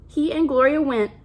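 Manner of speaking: ordinary delivery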